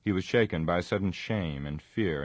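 plain reading voice

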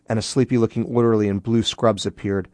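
usual speaking voice

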